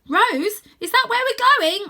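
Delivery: very high, loud worried question; boy shouting to Rose about the frozen peaks; alarmed shout. Acted. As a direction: shout in disbelief.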